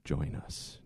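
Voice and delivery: eerie cult whisper; creepy whisper. Pretend. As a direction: breathe it like a cult recruiter. Mock cult invitation.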